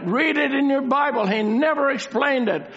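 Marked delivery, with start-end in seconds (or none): 1.3-2.7 high, insistent stress on "never"; emphasis on never. Real, not acted. Preacher repeating his point to listeners, strong emphasis on "never". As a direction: punch out "never" for the congregation. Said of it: "making a very special, uh, emphasis".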